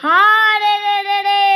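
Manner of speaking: long ringing bandit yell; bandits' war cry. A narrator's reading. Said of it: "ডাকছে রে".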